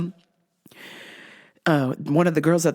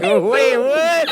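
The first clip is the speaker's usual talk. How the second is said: surprised; 5 semitones higher, 5 dB louder than usual. Retold or imitated. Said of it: "I was like".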